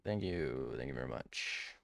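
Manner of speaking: low, rumbling mock-gracious thanks; deep voice. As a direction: drop way down like a crooner.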